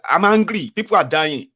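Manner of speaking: loud, high angry outburst; angry president. Shown as a demonstration. Showing listeners how an angry president should sound, loud outburst. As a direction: shout it like a furious leader. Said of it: "President is angry".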